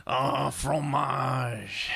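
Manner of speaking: put-on slow, drawn-out French accent. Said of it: "en français".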